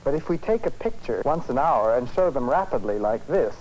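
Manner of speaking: ordinary talk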